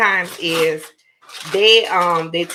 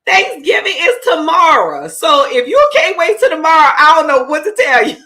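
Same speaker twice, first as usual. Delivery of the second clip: higher, louder deadpan mother; mock dismissive mom; 8 semitones higher, 8 dB louder than usual. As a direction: brush the kid off like mom. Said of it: "the mom is talking about".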